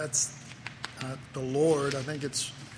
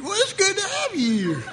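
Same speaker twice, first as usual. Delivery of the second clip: much higher, chuckling welcome from a daft God; mock God voice; 13 semitones higher, 4 dB louder than usual. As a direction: greet them like a bumbling, amused deity. God's silly reply in heaven.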